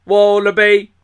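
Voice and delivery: loud put-on Aussie wallaby; mock Australian. Host tossing back the jingle word, mock Aussie. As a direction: punch it out Aussie-style.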